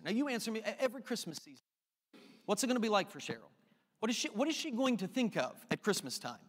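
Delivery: usual speaking voice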